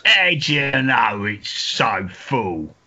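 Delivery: slow, drawn-out Cockney comeback; hammed-up Cockney straight man; Cockney accent. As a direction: stretch it out as a stage Cockney. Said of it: "let me do a Cockney accent".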